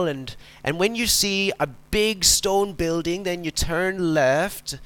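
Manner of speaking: a hint of an old Irish farmer giving directions; Irish farmer accent. Preacher telling a joke as an Irish farmer, in a light Irish accent. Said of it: "not very good at Irish accents".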